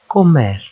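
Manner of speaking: comer run together as one word, falling at the end; joined syllables. Shown as a demonstration. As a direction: join CO and MER without a gap. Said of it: "two syllable words".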